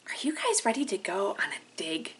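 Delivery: put-on fast secret whisper to the kids; excited whisper. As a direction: whisper the invitation like a secret adventure. Teacher hushes kids before activity.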